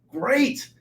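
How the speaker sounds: high, bright mock of a cheerful buddy; big Irish buddy. Retelling his SEAL buddy's call, high cheerful buddy voice. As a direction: play the tough old buddy insisting he's great. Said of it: "big Irish guy".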